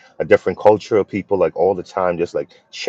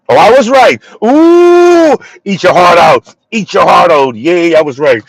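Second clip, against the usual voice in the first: much higher, loud, triumphant crowing; gleeful gloating; 9 semitones higher, 9 dB louder than usual. Real, not acted. Gloating to the viewer after guessing right, loud high crowing. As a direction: crow that you were right.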